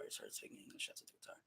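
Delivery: soft, fast whisper to himself; whispered aside. Real, not acted. Muttering a correction to himself, whispered. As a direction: breathe it out quickly, barely voiced.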